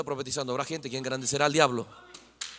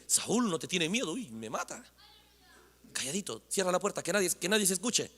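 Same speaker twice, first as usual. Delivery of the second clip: scared hush; 8 dB quieter than usual. Acted. Saul fearful, hushing.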